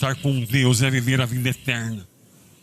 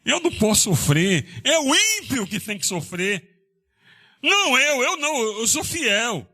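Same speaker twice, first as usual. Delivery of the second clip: high, indignant mock complaint; lightly voiced self-pity; a hint of a whining believer; self-righteous believer; 10 semitones higher than usual. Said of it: "ele falava essas palavras".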